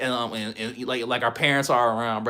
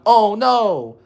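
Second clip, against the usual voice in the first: higher, louder mock gasp; hammed-up shocked oh no; mock scandalized; 9 semitones higher, 7 dB louder than usual. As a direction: play the prudish complainer. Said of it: "I'm not saying".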